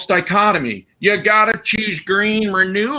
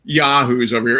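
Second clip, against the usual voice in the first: ordinary delivery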